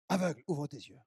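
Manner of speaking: a soft, hushed echo of the divine order; quiet command. Acted. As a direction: murmur it like an inner voice.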